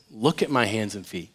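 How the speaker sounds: low, soft, reassuring voice; gentle invitation. Acted. Jesus showing his hands to hesitant disciples, gently. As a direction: offer it quietly to a doubter.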